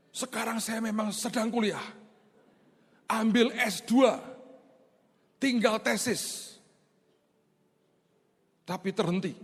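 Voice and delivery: answering the pastor like a subordinate; dutiful young officer. Retold or imitated. Brimob officer reporting his studies to pastor, lightly voiced and respectful.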